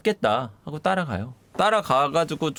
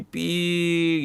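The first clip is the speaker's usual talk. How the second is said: imitated door creak; 2 semitones higher, much slower than usual. Retelling the door opening on a person, slow creak. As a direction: stretch it like a creaking hinge.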